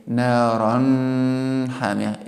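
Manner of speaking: long droning hum on the n of naran; wrong, elongated nun. Shown as a demonstration. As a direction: hold the n of naran far too long before hamiya. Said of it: "Do not elongate the pronunciation".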